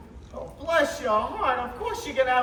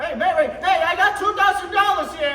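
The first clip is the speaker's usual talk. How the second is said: higher, loud shout across the room; calling out; 5 semitones higher, 7 dB louder than usual. Acted. George shouting his offer over the crowd, loud.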